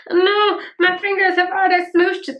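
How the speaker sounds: high, loud, half-joking cry of dismay; upset wail. Real, not acted. Fingers smooshed the pretty imprint, high dismayed wail. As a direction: wail as the imprint gets ruined. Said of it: "This is very upsetting".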